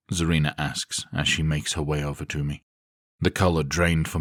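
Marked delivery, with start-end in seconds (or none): none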